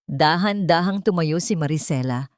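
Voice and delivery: normal talking voice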